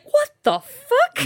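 very high, slow, stunned squeak; mock disbelief. Imitating Clint's look after the honk, mock disbelief. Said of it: "he's just like".

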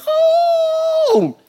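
very high, loud yelp; a sudden high squawk of a bird hitting glass; bird hitting window. Retold or imitated. Preacher showing his shock like a bird hitting a window, loud high squawk. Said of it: "like a fly when it... a bird when it hits the window".

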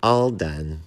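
loud, happy announcement; pleased and emphatic. A narrator's reading. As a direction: announce it gladly, with punch. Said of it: "said the bat happily".

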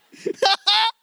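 laugh. Real, not acted. Laugh after joke.

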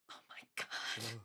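low, slow whispered awe; awed whisper. Retold or imitated. Viewer moved by Donna's line, low slow whisper. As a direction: breathe it out, moved. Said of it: "you're like".

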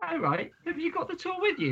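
pitched high, barely holding in laughs; high laughing disbelief. Retold or imitated. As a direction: ask it while fighting back laughter. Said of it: "I was like".

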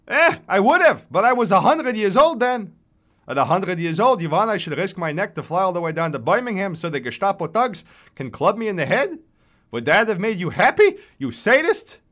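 put-on high, loud, outraged old man's rant; vigorous chirping old voice, very worked up; reedy old man. A narrator's reading. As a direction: plead your case in accented indignation. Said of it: "His voice is reedy but vigorous".

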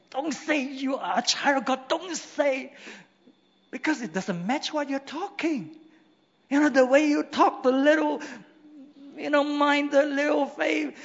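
fervent preacher voice; preaching at full force. Acted. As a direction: rouse the congregation.

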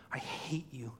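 soft whispered creature hatred; tearful whisper. Acted. Preacher as crying Smeagol facing Gollum, whispered hurt. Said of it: "And as Smeagol cries".

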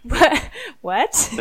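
loud, incredulous, laughing questions; laughing disbelief. Retold or imitated. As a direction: blurt it out in amused bafflement. Mock disbelief at TikTok's rise.

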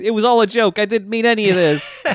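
high-pitched panicked backpedalling, played for laughs; mock begging. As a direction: plead your way out, hammed up. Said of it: "he's begging Koichi".

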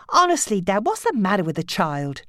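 a hint of a harried mother; exasperated daughter. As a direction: complain to her dad. Nancy voiced.

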